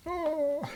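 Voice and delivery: very high imitated yawn; mock yawn. Showing her just yawning at the discount offer, mock yawn. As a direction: put on a yawn, voice sliding high. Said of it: "And she's just yawning".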